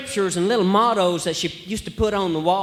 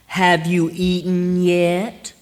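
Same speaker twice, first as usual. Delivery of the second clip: slow, fully pronounced Have you eaten yet; slow careful question; much slower than usual. Shown as a demonstration. Comedian showing what Southerners don't ask, slow and careful. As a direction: say every word slowly and fully. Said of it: "We say, "Jeet yet?".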